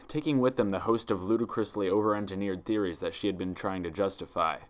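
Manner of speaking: ordinary delivery, nothing marked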